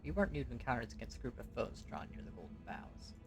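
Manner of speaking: ordinary delivery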